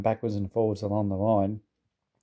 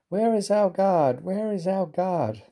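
much higher, slow mock-tearful cry; 8 semitones higher, slower than usual. A narrator's reading. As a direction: call out for the lost guard. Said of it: "they cried".

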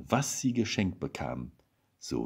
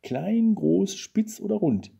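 high, louder guessing questions; eager pressing; 12 semitones higher, 4 dB louder, slower than usual. A narrator's reading. Wife quizzes husband about her present, eager pressing. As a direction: pester with options, voice right up high.

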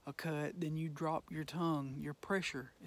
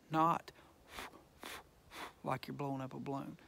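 ordinary delivery

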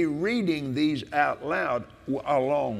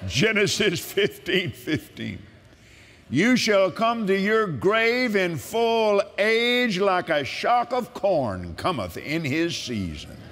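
preaching with force; 3 semitones higher than usual. Acted. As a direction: declare it like a sermon.

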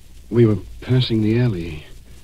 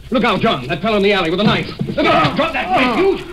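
frantic warning yell, much higher; alarmed shout; 13 semitones higher than usual. Acted. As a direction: scream a warning at the attacker. Attack in the alley.

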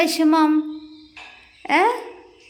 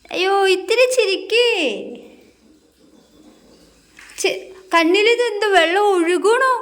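put-on higher, sing-song voice soothing a crying toddler; coaxing baby talk; 5 semitones higher than usual. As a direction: coax the little one to stop crying.

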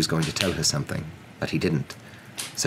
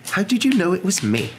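high, louder acted question; surprised professor; 5 dB louder than usual. Ruffled professor asks how she was recognised, high surprised question. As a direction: ask it pitched high, caught out.

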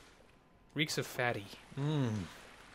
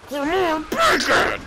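much higher, loud, slow yell; mock battle cry; hammed-up shout; 13 semitones higher, 13 dB louder, slower than usual. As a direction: bellow it as a taunt. Player shouting at pig.

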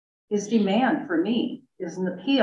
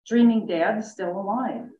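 plain reading voice

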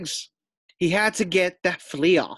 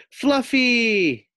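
high, louder stretched name; announcing the winner; 8 semitones higher, 6 dB louder than usual. Acted. Teacher names the winning pig, loud announcement. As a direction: call out the winner.

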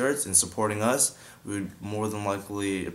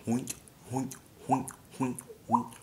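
hoink with a breathy h, hollow pop; hoink drip; 4 semitones higher, 5 dB quieter than usual. Shown as a demonstration. Teaching the drip, hollow hoink pops. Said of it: "once you get that hoink sound down".

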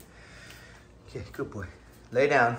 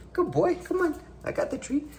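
much higher squeaky coaxing; squeaky praising a dog. Real, not acted.

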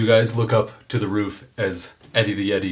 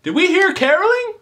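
very high, louder, eager voice; excited townsperson; 6 dB louder than usual. Acted. Townsperson calls out to the party, eager and high. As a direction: pipe up like a cheerful villager. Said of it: "somebody said".

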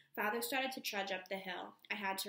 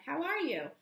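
high, loud, lively question; cheery greeting; 7 semitones higher, 6 dB louder than usual. A narrator's reading. Miss Whitlaw asking after Moonshadow by telephone, cheery. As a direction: swoop up high in friendly inquiry. Woman greets narrator on phone.

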